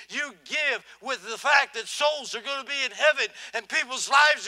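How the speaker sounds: loud, high, driving sermon voice; full-force preaching. Real, not acted. Preacher rousing the congregation about giving, fervent. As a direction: preach it to the back row.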